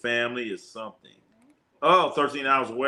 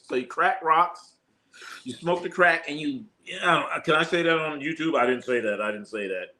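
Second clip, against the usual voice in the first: ordinary talk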